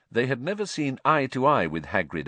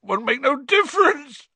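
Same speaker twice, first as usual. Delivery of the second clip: high, loud sobbing wail; 8 dB louder than usual. Acted. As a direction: sob it out, voice up high. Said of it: "sobbed Hagrid".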